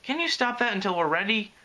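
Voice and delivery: high-pitched, annoyed request; mildly exasperated plea to stop; irritable. A narrator's reading. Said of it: "Twilight said irritably".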